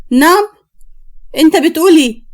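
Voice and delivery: sharp, high-pitched challenge; outraged, refusing to believe it; indignant disbelief. Acted. Sister outraged at doctor's diagnosis.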